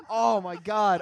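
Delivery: usual speaking voice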